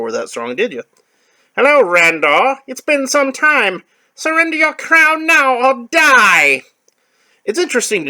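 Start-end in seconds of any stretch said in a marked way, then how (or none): 1.5-6.6 high, loud, slow menacing villain; shrill skeletal menace; villain threat. Acted. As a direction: taunt the king high and slow.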